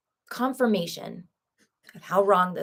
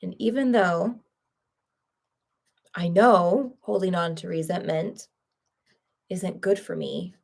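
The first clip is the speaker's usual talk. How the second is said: tapping affirmation. Acted. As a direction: recite each setup line calmly and deliberately. EFT tapping setup script.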